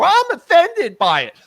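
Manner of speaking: high, drawn-out mocking whine of the offended. Mocking offended complainers in rant.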